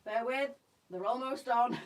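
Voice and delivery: out of breath while wriggling into leggings; breathless, pulling on tight leggings. Real, not acted. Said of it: "if I sound out of breath".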